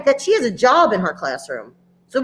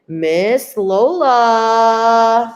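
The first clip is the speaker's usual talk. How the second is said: very slow, drawn-out guest introduction; grand, lingering welcome; announcer introduction; much slower than usual. Real, not acted. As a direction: stretch the name out like an announcer. Host introduces the pre-K guest.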